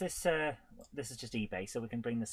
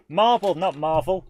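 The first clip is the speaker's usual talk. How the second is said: MARBLE punched loud, set against Marvel; emphatic correction; 3 semitones higher, 8 dB louder than usual. Real, not acted. Streamer correcting the game's name for chat, MARBLE stressed loudly against Marvel. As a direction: hit MARBLE hard, then contrast it with Marvel. Correcting a misheard game title.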